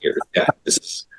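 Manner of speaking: mock child whispering to his dad; hushed urgent boy's whisper; whispered kid. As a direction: whisper it like a kid who found something. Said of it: "like, going".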